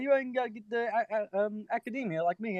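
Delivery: high British shopkeeper putting on learning; mock British accent. Reader quotes the shopkeeper's academy boast, mock British accent. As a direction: stumble through it in a high, broken British voice. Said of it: "Oh, he sounds British".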